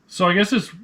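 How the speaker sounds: ordinary talk, nothing marked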